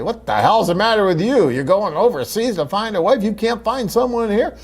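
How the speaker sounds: high, loud mock outrage; mocking critics. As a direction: sneer it like a scornful critic. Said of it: "So when other people say".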